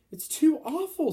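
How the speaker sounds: high, loud, slow anguished cry; Sophie crying out. Acted. Girl in giant's pocket, horrified, cries out loud. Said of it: "Sophie cried".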